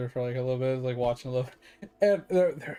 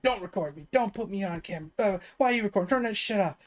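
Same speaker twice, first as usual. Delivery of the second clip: mock angry girlfriend; 6 semitones higher, faster than usual. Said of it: "He kept slapping him and said".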